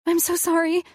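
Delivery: high, upset plea; tearful apology. A narrator's reading. Woman apologizing to her partner mid-panic, tearful. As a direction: apologize high-pitched, on the edge of crying. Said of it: "I started crying".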